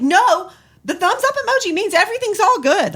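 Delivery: high, loud exasperated insistence; indignant protest. Retold or imitated. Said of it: "I'm like".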